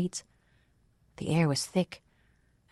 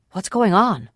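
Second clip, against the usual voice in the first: higher, louder startled question; alarmed question; 5 semitones higher, 6 dB louder, slower than usual. A narrator's reading. Oric reacts to horses and shouting overhead, alarmed. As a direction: ask it urgently, caught off guard.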